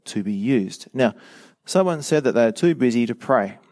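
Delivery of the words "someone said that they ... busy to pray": ordinary talk, nothing marked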